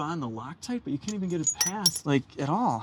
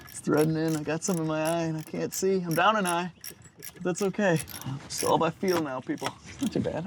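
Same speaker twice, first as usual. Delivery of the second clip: ordinary talk, nothing marked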